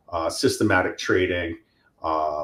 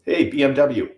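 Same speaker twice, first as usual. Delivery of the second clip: louder, higher call to a car assistant; mock yell; 5 semitones higher, 5 dB louder than usual. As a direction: play at yelling a voice command. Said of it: "I yell into my car".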